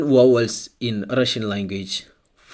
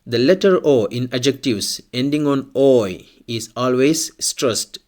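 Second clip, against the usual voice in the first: usual speaking voice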